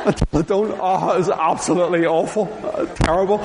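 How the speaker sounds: high, breaking into helpless laughter; giggling fit over the memory; real laughter. Real, not acted. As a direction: laugh it off, still mortified. Recalling embarrassing moment.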